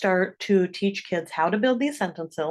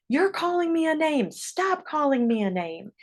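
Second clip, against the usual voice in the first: higher-pitched mock accusation; accusing; 7 semitones higher than usual. Kid in a conflict blaming the other child, mock accusing. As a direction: act out going on the attack, lightly hammed-up. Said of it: "we tend to start attacking".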